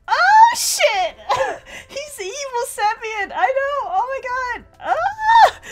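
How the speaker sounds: very high, loud excited squeals; excited shrieking. Real, not acted. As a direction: shriek with shocked delight. Realizes it's evil Semyon.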